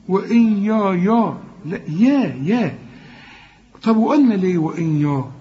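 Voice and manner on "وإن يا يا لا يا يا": ordinary talk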